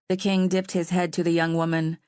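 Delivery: usual speaking voice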